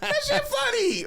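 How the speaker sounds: very high, loud squeal through laughter; laughing protest. Acted. Comedian answering a sympathetic crowd, laughing protest. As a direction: insist on it while cracking up.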